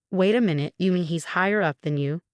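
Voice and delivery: ordinary delivery, nothing marked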